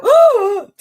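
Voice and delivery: imitating a high, loud startled "Oh!"; mock surprise of a photobombed fan. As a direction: yelp like a fan caught off guard. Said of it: "the people would turn around and go".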